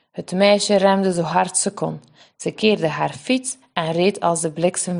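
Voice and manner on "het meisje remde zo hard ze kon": usual speaking voice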